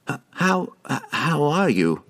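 halting, stammered question; stammering. A narrator's reading. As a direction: trip over the first word nervously. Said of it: "Harkle stammered".

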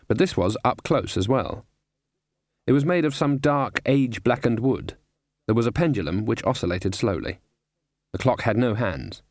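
usual speaking voice

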